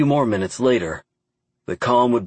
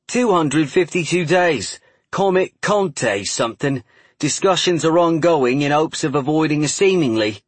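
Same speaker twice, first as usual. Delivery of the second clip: put-on Cockney accent; 6 semitones higher than usual. A narrator's reading. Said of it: "broad Cockney accent".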